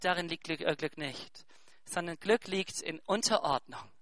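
a calm hush as God answers the world; soft voice of God. Retold or imitated. Preacher softly voicing God's reply to the world, hushed. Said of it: "Und Gott sagt".

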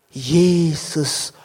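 low halting words, barely squeezed out; trembling. Retold or imitated. Nervous deacon's first sermon words, low and halting. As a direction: force out two words through nerves. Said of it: "fing an zu zittern".

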